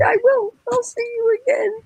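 lightly voiced Frank promising to see her again; tenderly imitating Frank. Voicing Frank's tender goodbye.

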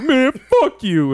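high, loud, drawn-out mock outburst; imitated angry brush-off. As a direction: throw it out slowly, imitating a sore loser. Retells opponent backing off angrily.

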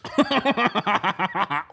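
long run of open ha-ha laughter; helpless cackle at a ridiculous name; big laugh. Real, not acted. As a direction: burst out laughing. Reacting to the town's name.

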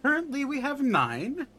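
put-on elderly nun answering.

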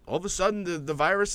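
high, amazed restart; sudden surprise. Shown as a demonstration. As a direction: lift the voice in mock amazement. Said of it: "Like, all of a sudden".

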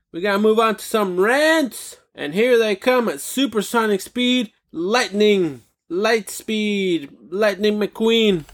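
high, drawn-out show announcer voice; hyped announcer. Pretend. Host introducing the rants at supersonic speed, hyped announcer. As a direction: announce them like speeding racers.

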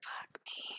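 defeated whisper under the breath. Real, not acted. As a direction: whisper it to yourself in dismay. Dismayed by the new character.

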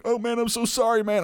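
hammed-up sheepish backpedal; mock apology.

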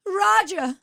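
very high, plaintive mock cry; scared mid-Atlantic accent. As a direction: call out like a stranded film damsel. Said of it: "they got so scared".

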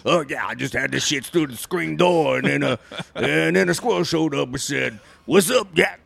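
hammed-up Cajun storyteller; thick Cajun accent. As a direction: spin a nonsense yarn in mock Cajun. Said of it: "anything in that accent you could say".

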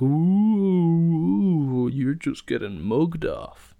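loud, high mocking ooh; mocking jeer. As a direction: jeer at him like a rowdy crowd. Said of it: "everyone was like".

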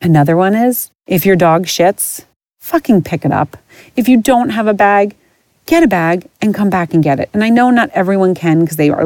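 normal talking voice

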